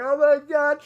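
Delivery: high, loud, choked-up imitation of sobbing; teary oh my God. Retelling how the movie's ending made her cry, high and choked. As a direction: replay the moment you broke down crying. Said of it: "I'm coughing and I'm crying and I'm like".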